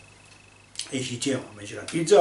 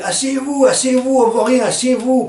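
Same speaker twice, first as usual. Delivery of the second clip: shrill demand; 11 semitones higher, 5 dB louder than usual. Retold or imitated. Woman at concert demanding they sit.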